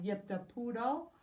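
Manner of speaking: ordinary delivery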